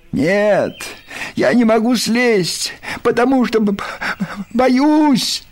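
high-pitched scared stammer, rising to a cry; frightened voice. A narrator's reading. Stuck up a tree, calling down to friends, frightened. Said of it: "донёсся сверху испуганный голос".

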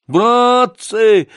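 high, loud wailing cry; trembling, tearful appeal. Acted. Гундосый to his comrades, tearful wail. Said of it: "говорил он дрожащим, плачущим голосом".

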